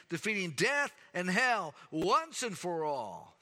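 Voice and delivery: high, loud, building sermon; fiery preaching. Acted. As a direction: preach it out to the whole church. Preacher at full force.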